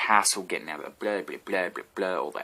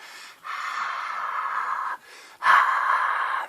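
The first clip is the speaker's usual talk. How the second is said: long breathy crowd sigh; crowd dying down. Pretend. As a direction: let the crowd noise fade. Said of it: "That's my imaginary crowd there".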